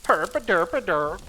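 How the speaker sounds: hammed-up chef babble; Swedish chef impression. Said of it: "the Swedish chef".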